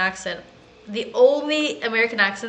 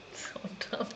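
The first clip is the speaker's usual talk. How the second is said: whispered; 16 dB quieter than usual. Real, not acted. Mutters to self after laughing.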